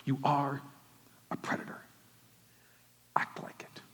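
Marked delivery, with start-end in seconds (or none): 1.3-3.8 whispered, low, every word pressed; a soft whispered dare to the congregation; intense whisper. Real, not acted. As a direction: breathe the challenge at them like a secret. Preacher whispers the charge.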